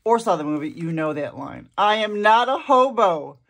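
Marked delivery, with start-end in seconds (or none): 1.8-3.4 loud quote. Retold or imitated. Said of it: "my most favorite lines in the book".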